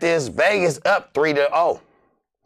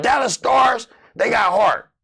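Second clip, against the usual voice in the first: fired-up shout of respect; hyped praise; 6 semitones higher than usual. Real, not acted. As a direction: pump up the Dallas Stars. Hyping the team.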